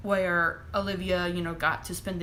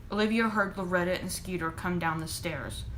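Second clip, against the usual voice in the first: ordinary delivery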